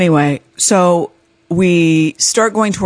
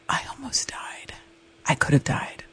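whispered drama; 2 semitones lower, 13 dB quieter than usual. Retold or imitated. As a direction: confide the near-death in a hush. Dramatic confession to the skier.

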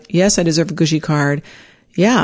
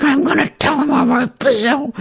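determined; 9 semitones higher than usual. Retold or imitated. Slamming the car door, resolved.

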